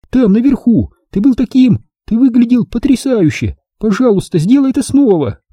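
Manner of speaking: put-on very high, gushing girl's plea; excited crying out. A narrator's reading. Mia admires Jack and begs him to fly, excited cry. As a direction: exclaim in admiration and beg eagerly. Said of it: "закричала Мия".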